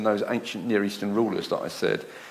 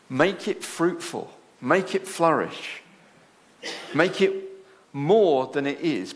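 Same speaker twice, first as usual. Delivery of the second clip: slow solemn decree; 2 semitones higher, slower than usual. Retold or imitated. God charging humanity, slow emphatic.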